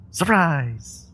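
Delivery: bright high "Surprise!" sprung on him; spring the news like a party trick; announcing she's his secret kid, playfully; playful surprise reveal. Acted.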